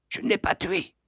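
hushed, high and soft confidence; whispered. A narrator's reading. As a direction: whisper it secretly to your neighbour. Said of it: "chuchotai-je à Thwaites". (chuchoté à un camarade)

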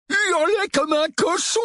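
very high, drawn-out mocking yell; jeering gang boy. Gang jeers as they part. (voix de voyou railleur, très aiguë)

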